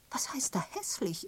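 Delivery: high-pitched, indignant comeback; sharp retort. A narrator's reading. Miss Prescott answering the canon's reproof, sharp. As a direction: throw the accusation back in a high voice. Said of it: "entgegnete Miss Prescott scharf".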